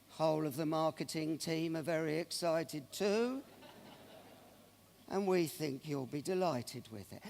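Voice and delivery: low, level monotone sales pitch; mock a dull pitch, low and without passion; unenthusiastic pitch. Presenter mimicking a bad pitch meeting, passionless drone. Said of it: "That's not the way to pitch".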